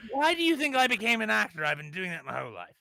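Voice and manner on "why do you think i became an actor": high loud mock outrage; mock indignant. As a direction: protest in hammed-up offense.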